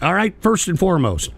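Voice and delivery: ordinary delivery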